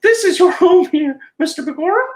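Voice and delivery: squeaky friendly cartoon mouse; Mickey Mouse falsetto. Mickey voice again.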